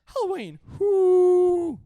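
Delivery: high, loud whoop; excited hype. Pretend. Podcaster hyping Halloween to his co-host, high whooping cheer. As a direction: shriek it like a party cheer.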